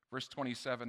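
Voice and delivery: normal talking voice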